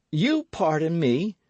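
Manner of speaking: high, loud, stress on "You" rising; indignant cry. A narrator's reading. D'Artagnan retorting to the musketeer, high indignant cry. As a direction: throw the word "You" back at him. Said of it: "cried D'Artagnan".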